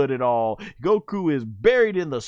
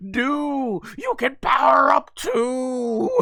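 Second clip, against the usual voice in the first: high, slow, cheering cartoon voice; excited cartoon cheer; 9 semitones higher, 4 dB louder, slower than usual. Majin Buu cheering his brother to power up. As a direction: cheer him on like a giddy little brother.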